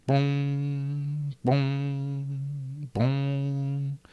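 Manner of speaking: clock chimes. Acted. Narrator sounding the city clocks striking three, tolling. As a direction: toll it like a distant bell.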